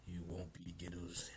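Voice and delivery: low, hushed near-whisper; quiet withholding. Retold or imitated. Loki refusing Luffy free answers, quietly guarded. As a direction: play Loki softly holding out.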